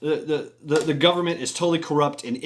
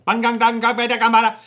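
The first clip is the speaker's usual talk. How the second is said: high, loud gibberish in mock Indonesian accent; 10 semitones higher, 6 dB louder, faster than usual. Shown as a demonstration. Storyteller struggling to demonstrate Tam's speech, exaggerated Indonesian accent. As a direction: parody the Indonesian accent with nonsense syllables. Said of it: "Indonesian accent".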